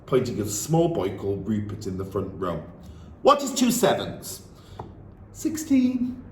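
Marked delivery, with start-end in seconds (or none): 3.2-4.4 sharp, high, loud bark. Acted. Headmistress singling out a small boy, barked loud. Trunchbull questions Rupert. 5.3-6.2 put-on high, carefree child voice; foolish little boy. Small boy answering the headmistress, high and carefree. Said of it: "answered with foolish abandon".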